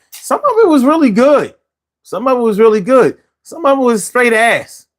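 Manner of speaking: high, drawn-up emphatic verdict; emphatic high pitch. Real, not acted. Host sums up garage music, emphatic and high-pitched.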